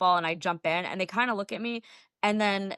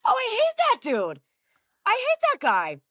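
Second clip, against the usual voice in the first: much higher, louder hammed-up disgust; squealing mock disgust at a rival; mock outrage; 13 semitones higher, 3 dB louder than usual. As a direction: play up the fake hatred with a laugh in it. Retold mock outrage at rival pundit.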